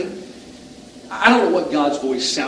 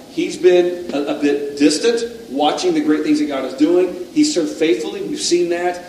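normal talking voice

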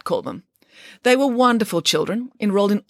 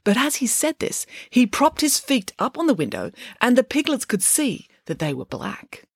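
ordinary delivery, nothing marked

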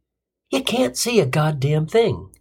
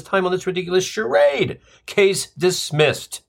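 raised-pitch mock judicial rant; indignant judge; 5 semitones higher than usual. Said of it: "To which the judge replied".

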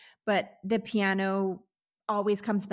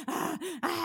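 flustered fangirl squeal; 8 semitones higher, 4 dB quieter than usual. Retold or imitated. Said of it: "And I'm like".

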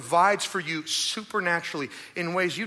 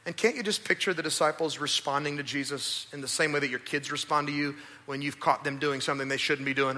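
usual speaking voice

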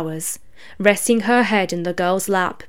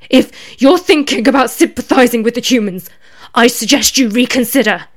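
louder, desperate raven voice; desperate warning; 5 semitones higher, 7 dB louder than usual. A narrator's reading. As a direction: warn her with a touch of desperation. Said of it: "a hint of desperation in his voice".

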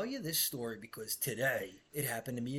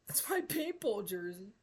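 much higher, mocking whiny boss voice; 9 semitones higher than usual. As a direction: mimic him to make him sound silly. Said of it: "He tells me".

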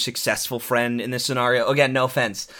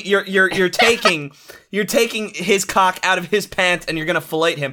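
usual speaking voice

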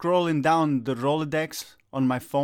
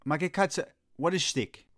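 put-on thick Italian accent voice; 2 semitones higher than usual. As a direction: play the confused Italian uncle. Italian immigrant character voice.

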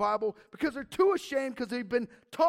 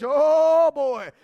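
loud threatening mock preacher; hammed-up hellfire warning; 9 dB louder than usual. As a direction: bellow it like a scare-sermon.

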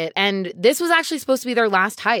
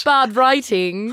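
slow, higher, hammed-up British accent; mock British accent; 4 semitones higher, 4 dB louder, much slower than usual. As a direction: draw it out in fake British. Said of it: "Are we being British?".

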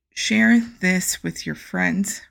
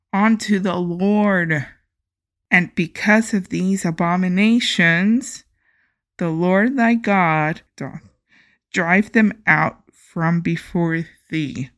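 loud, solemn Bible reading; scripture reading; 6 dB louder than usual. Acted. Podcaster reading scripture to listeners, solemn and loud. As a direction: proclaim the verses like a preacher.

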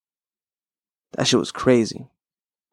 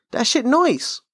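squeaky high voice for the repeat; much higher mock voice on the repeat; high put-on voice; 12 semitones higher, slower than usual. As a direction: echo your own line way up high.

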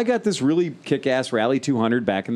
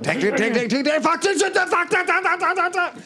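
climbing much higher as the engine screams; revving engine imitation. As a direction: rev from "tack" up to a high buzzing "that".

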